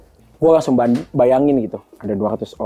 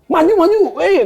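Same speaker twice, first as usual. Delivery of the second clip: loud excited yell at the game; noisy gamer shout; 5 dB louder than usual. Retold or imitated. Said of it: "Jadi aku berisik kayak". (teriakan heboh main game)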